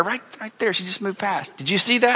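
quiet, urgent nudge to friends; whisper-soft, pointing out the widow; hushed urgent aside to his disciples. Retold or imitated. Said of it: "Jesus voiced in story".

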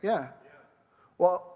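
ordinary delivery

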